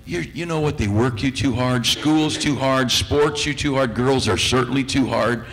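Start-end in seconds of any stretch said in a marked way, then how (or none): none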